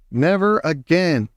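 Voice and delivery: ordinary delivery, nothing marked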